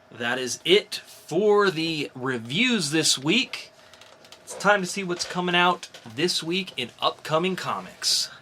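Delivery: bright comic-show announcer listing new releases; announcer read. Acted. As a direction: sell each title like a podcast host. Hosts upcoming comics rundown.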